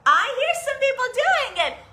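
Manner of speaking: usual speaking voice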